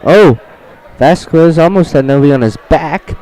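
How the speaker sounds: live wrestling call; play-by-play. Acted. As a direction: call the action like an announcer. Announcer calls the match.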